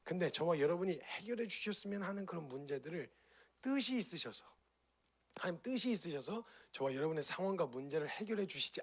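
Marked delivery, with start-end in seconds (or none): none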